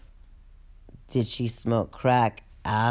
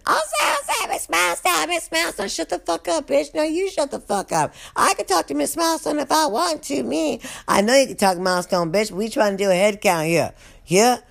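much higher, mocking squabble; bickering inmates; 9 semitones higher, faster than usual. Inmates trading insults during the head count, high mock squabble. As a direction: sass each other in a high put-on voice.

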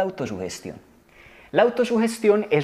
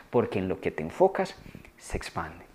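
dropped low and soft, almost breathed; a low, hushed close to the point; low hushed punchline; 8 semitones lower, 7 dB quieter than usual. Real, not acted. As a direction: let the maxim sink in quietly. Repeats the maxim as punchline.

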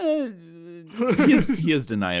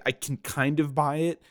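ordinary delivery

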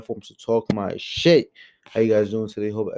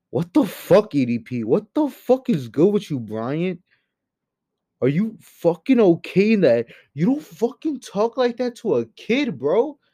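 angry disbelief; 7 semitones higher than usual. Real, not acted. Vlogger berating a disgraced YouTuber, angrily. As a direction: confront him like he is in the room.

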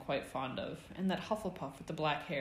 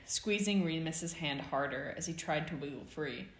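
ordinary delivery, nothing marked

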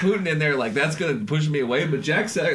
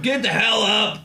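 higher, louder bossy order; bossy shout; 8 semitones higher, 4 dB louder than usual. Retold or imitated. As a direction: bark it at him like a bossy manager. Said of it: "She'd be, like, just bossing him around".

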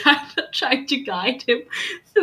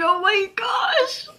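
squealing; 10 semitones higher, slower than usual. Real, not acted. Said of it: "I'm just screaming".

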